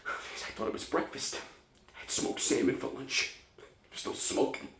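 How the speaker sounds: breathy, voiceless aside through the pain; panicked patient running out of breath; winded gasping. Acted. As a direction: puff the words out, short of air. Panicked patient gasps between orders.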